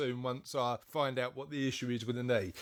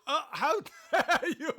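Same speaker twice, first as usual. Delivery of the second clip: high, loud, hammed-up British indignation; mock outrage; 12 semitones higher, 6 dB louder than usual. Podcast host playfully protesting the flamboyance charge, mock outrage. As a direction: draw it out as an affronted Brit, laughter breaking in. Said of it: "There it goes with the British accent".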